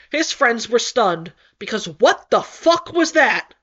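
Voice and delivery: ordinary delivery, nothing marked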